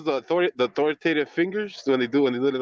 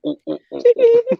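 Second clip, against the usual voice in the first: ape hooting; 8 dB louder than usual. Retold or imitated. Mocking [name] as ape.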